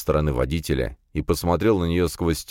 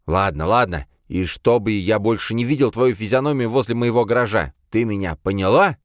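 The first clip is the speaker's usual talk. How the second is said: threatening; 5 semitones higher, 3 dB louder than usual. Acted. Paul threatens her.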